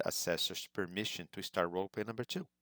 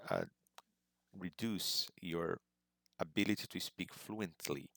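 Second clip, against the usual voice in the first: usual speaking voice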